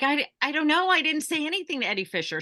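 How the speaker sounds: high, stammering, baffled protest; flustered denial. Retold or imitated. Clerk protesting to coworkers she said nothing, flustered and high. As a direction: deny it in a startled rush.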